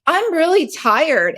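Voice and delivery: put-on high, slow, drawn-out kid protest; inner child complaint. Inner child answering the busy adult, high slow complaint. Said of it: "My inner child's like".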